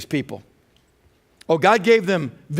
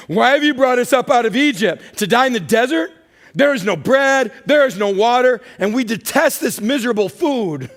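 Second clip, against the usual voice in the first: high, loud mocking whine of grumbling people; hammed-up nagging protest, pitched up and loud; whiny complaint; 6 semitones higher, 4 dB louder than usual. Preacher mocks the grumbling Israelites in a loud, high whine. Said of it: "and they said".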